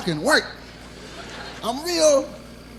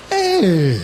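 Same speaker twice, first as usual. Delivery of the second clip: excited discovery; 4 semitones higher than usual. Retold or imitated. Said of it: "like I said".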